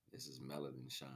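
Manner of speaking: ordinary delivery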